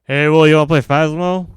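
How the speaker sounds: loud, high call across the room. Real, not acted. Calling Will over to play, loud and high. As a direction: shout it out to grab his attention.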